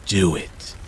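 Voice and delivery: soft menacing hiss. A narrator's reading. Evil voice urges the boy, soft hiss. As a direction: hiss it close into his ear. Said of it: "a voice hissed into my ear".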